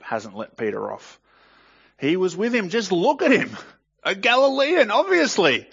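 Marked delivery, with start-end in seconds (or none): 2.0-3.6 higher, louder pointing-out voice; taunting accuser. Acted. 4.0-5.7 much higher, louder sneer on "Galilean"; sneering accuser. Acted. Accuser mocks the accent.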